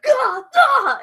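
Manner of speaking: high, loud cry of alarm; imitated freak-out, loud and high-pitched; freaked-out yelp. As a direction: yelp in mock panic. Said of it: "of freak out".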